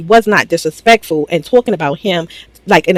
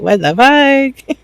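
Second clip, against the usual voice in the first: slow, dragged-out, higher mock outrage; mock baffled guys; 7 semitones higher, much slower than usual. The guys reacting to the cheering, slow mock outrage.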